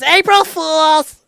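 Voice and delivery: drawn-out high shrieking April Fools, slow and loud; mom pranking. Retold or imitated. Mom springs her April Fools prank on her son, shrieking gleefully. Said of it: "And then she goes".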